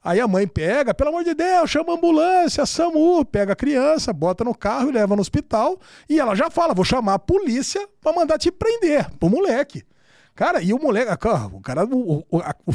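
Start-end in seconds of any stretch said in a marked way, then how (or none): 0.9-3.2 high, urgent mock pleading; retold panic, pitched well up; panicked mother. Acts panicked mother calling for help. 6.7-9.1 threatening mother. Retold or imitated. Acts mother threatening the bully.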